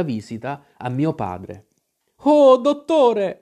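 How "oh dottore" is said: high, loud, drawn-out appeal; urgent cry. A narrator's reading. Mother and son greet the doctor, high urgent cry. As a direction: cry out for help, stretching the words.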